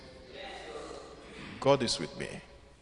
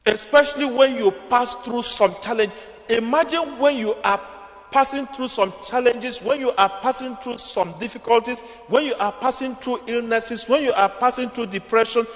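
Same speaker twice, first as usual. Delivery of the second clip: higher, repeating "when you are passing" with swelling drive; preaching build; 8 semitones higher than usual. Real, not acted. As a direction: lay it on line after line. Rising sermon litany.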